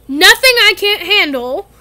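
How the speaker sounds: a big man brushing off worry; Hagrid voice. Acted.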